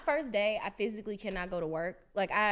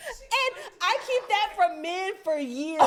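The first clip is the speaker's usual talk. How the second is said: mock confession; 9 semitones higher, 5 dB louder, slower than usual. Mock confession after burping.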